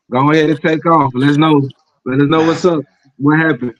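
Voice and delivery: ordinary talk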